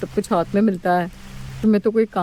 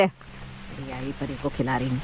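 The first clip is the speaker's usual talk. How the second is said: soft aside; 7 semitones lower, 10 dB quieter, slower than usual. Acted. Grandmother to baby.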